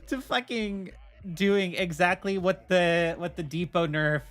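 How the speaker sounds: ordinary talk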